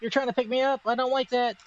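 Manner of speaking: a cat complaining in a high voice; voicing the cat. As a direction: play the cat protesting, squeaky and high. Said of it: "It's like".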